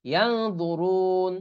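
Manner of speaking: ইয়ানদুরুন very slow with nasal ইয়াং; slow ikhfa demo. Shown as a demonstration. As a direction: hum the ন before দু. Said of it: "একই নিয়ম". (ধীরে গুন্নাসহ ইয়ানদুরুন)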